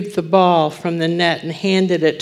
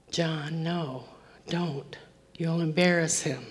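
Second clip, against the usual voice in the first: hushed plea; 11 dB quieter than usual. Retold or imitated. Wife pleading, holding husband back.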